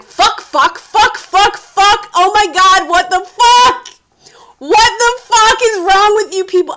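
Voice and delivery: loud, high string of curses; furious inner cursing. Retold or imitated. Thinking about the crew while exhausted, loud furious cursing. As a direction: explode in your head at everyone. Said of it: "all of it very angry".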